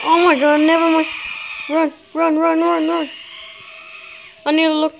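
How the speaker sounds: frantic, loud cries to flee; panicked shouting. Real, not acted. Player fleeing the monster, shouting in panic. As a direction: yell it out in real panic.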